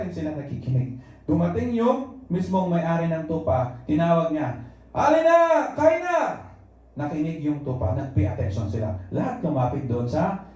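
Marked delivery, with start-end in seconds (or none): 4.9-6.4 high calling voice, much higher; the owner calls his flock home; the farmer calling his own sheep to eat. Retold or imitated.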